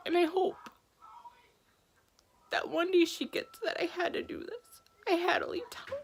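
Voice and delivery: trying not to cry while speaking; real tearful catch rising in pitch; holding back tears. Real, not acted. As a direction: voice high and breaking, fighting tears. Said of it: "I'm trying not to cry".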